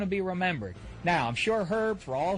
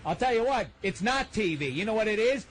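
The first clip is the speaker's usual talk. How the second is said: usual speaking voice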